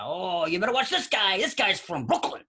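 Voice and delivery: loud, high Brooklyn guy impression; Brooklyn accent. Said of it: "he kept saying".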